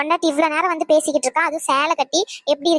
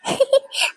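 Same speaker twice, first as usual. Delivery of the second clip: laugh; 9 semitones higher than usual. Acted. Wife laughs at husband's teasing.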